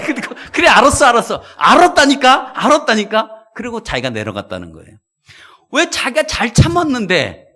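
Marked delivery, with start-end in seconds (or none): 0.1-3.5 higher, louder, laughing give-in; laughing surrender. Acted. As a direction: cave in with a laugh. Man gives in, laughing. (웃으며 크게 항복하는 목소리)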